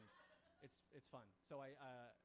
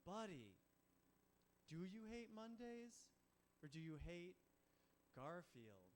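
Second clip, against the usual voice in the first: pointed confrontation; 5 semitones higher, 5 dB louder, slower than usual. Acted. Owner confronting Garfield about Mondays, slow and pointed. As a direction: space it out like a hard truth.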